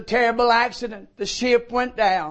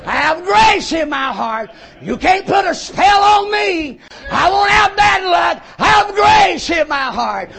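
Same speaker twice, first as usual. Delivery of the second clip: loud, high, defiant shouting; defiant shout at a cat; 7 semitones higher, 6 dB louder than usual. Retold or imitated. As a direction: yell it down like a man unafraid. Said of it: "And I said to that cat".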